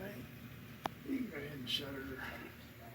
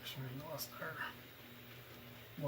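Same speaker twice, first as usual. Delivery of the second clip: slow, whispered muttering to himself; 9 dB quieter, much slower than usual. Real, not acted. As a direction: mutter it under your breath. Muttering to self over lost part.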